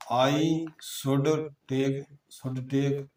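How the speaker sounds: teacher dictates slowly so pupils can write; slow dictation. Shown as a demonstration.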